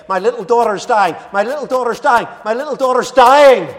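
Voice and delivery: put-on a frantic father begging for his dying child; desperate pleading. Father begging Jesus, building desperate plea. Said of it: "He pleaded again and again and again".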